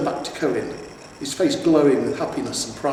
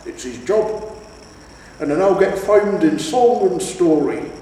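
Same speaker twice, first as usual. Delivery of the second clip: loud, high lecturing troll; proud troll father; 4 semitones higher, 7 dB louder than usual. A narrator's reading. Troll father lecturing his son about the hero, loud. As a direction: scold the lad into respect.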